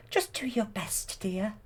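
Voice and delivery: high, soft fairy voice; Fauna. Retold or imitated. Fauna encouraging Merryweather, high soft fairy voice. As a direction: encourage gently in a small high voice. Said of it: "and then Fauna says".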